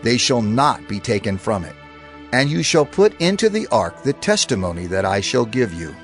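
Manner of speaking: normal talking voice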